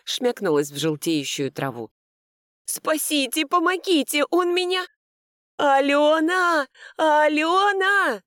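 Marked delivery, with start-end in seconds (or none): 2.7-4.8 high-pitched panicked wail; wailing for help. A narrator's reading. Sister knocked down by the dog, wailing for help. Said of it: "снова завопив". 5.6-8.2 high, loud, drawn-out cry; hysterical scream. A narrator's reading. Mother sees daughter knocked down, hysterical scream. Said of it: "истерично закричала Фаина Семёновна".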